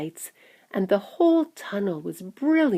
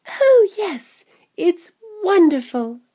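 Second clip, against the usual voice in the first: high, loud delight; delighted boy; 12 semitones higher, 6 dB louder, slower than usual. Acted. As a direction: exclaim like a thrilled child. Delighted boy tastes the chocolate.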